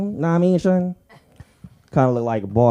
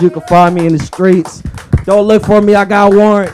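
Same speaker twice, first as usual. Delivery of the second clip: loud, higher closing shout; loud sign-off; 5 semitones higher, 11 dB louder than usual. Real, not acted. Comic signs off to crowd, loud and high. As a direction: belt it out to close the set.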